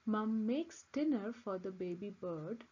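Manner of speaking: plain reading voice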